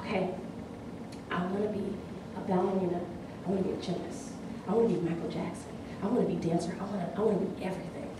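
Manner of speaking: put-on hushed little girl's secret wishes; whispered child. Little girl whispers her dreams to her father, hushed. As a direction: whisper it like a shy child confiding. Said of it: "she whispers to me".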